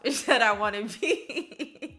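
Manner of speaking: loud, high, breaking into laughter; laughing. Real, not acted. Vlogger declaring who she wants to be, real laughter. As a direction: say it loud and let the laugh take over. Laughs through her bold claim.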